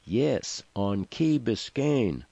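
restrained narrated shout; mild shout. A narrator's reading. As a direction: call it out slowly to be understood. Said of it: "shouted Seth".

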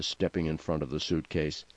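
ordinary delivery, nothing marked

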